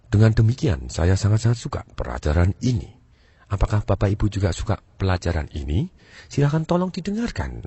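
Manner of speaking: auditory type, pitch swooping in waves; undulating tone. Shown as a demonstration. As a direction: let each phrase dip low and glide up. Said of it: "nadanya mulai mer-, bergelombang". (nada bergelombang, naik turun)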